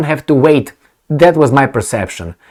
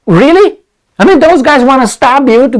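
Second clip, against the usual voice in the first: much higher, louder rising "Really?"; incredulous; 11 semitones higher, 5 dB louder than usual. Real, not acted. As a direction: squeak it in disbelief. Incredulous outburst.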